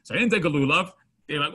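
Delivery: ordinary talk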